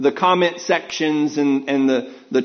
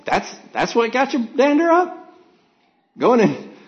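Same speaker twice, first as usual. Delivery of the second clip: incredulous; 9 semitones higher than usual. Retold or imitated. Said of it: "And I was like".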